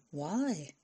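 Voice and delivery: softly asked, level dropped; soft question. A narrator's reading. Fran asks the girl why she wants this, softly. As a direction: ask it gently, under your voice. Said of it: "Fran asked softly".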